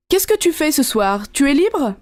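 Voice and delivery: ordinary delivery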